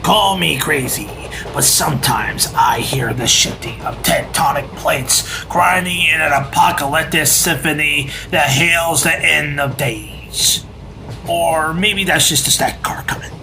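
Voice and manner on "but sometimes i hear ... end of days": doom whisper. Acted. Eerie doom prophecy by odd pony.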